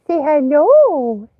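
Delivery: slow, loud prompt to the dog. Real, not acted. Owner prompting the dog to greet viewers, slow and loud.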